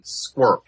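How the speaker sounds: squirrel said as a single syllable, skworl; one-syllable squirrel. Shown as a demonstration. Alabama speaker insisting squirrel is one syllable. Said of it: "no, listen, listen".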